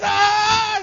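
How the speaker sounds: very high, loud squeal; hammed-up scream of delight; happy child's scream. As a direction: imitate a kid shrieking happily. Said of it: "He gets so happy".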